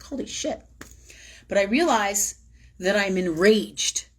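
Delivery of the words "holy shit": high, softer stunned exclamation; quiet startled gasp of words; hushed shock. Retold or imitated. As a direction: breathe it in disbelief. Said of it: "And I'm like".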